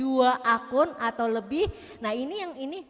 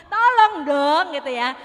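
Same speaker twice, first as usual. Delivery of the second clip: "Tolong dong" shouted much louder; angry; 3 semitones higher, 11 dB louder than usual. Shown as a demonstration. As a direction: bark "Tolong dong" loudly like you're fed up. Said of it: "dengan nada marah". (nada marah, "Tolong dong" diteriakkan keras)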